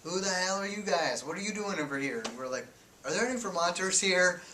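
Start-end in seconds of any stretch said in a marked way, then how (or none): 0.0-2.2 put-on loud, high Midwestern Guardsman, thick accent. Iowa Guardsman challenging strangers at gate, loud Midwestern accent. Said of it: "in a thick, like, Midwestern accent". 3.0-4.4 loud and high, shouted across the gate; calling out. Retold or imitated. Journalists calling to soldiers through the gate, loud shout.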